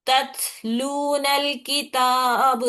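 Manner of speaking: तत्लूनल किताब drawn out very slowly, syllable by syllable; slow recitation demo. Shown as a demonstration. Teacher slowly recites the joined words for students. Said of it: "नल, लाम को नून से मिलाते ऐसा".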